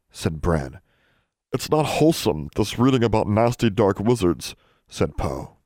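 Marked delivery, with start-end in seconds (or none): 1.5-4.5 lightly voiced disapproving elf, a little higher; worried elf. A narrator's reading. Worried elf Poe objects to Alexandra's dark reading, mildly fretful. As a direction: fret as a small scandalised elf. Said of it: "increasingly worried about Alexandra's preoccupation".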